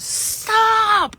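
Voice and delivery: long, high, loud scream; retold scream. Recalling a dream intervention, screamed out. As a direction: scream it out to stop a beating. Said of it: "and I screamed out".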